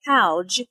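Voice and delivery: howj said as one syllable, how plus j; howj contraction. Shown as a demonstration. Teacher showing students the spoken form of how did. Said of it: "the contraction for how did sounds like".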